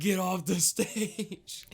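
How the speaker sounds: high, drawn-out heckler's cry; long, stretched mock holler; mock heckling. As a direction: imitate a bored crowd booing someone off. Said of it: "everybody was spamming".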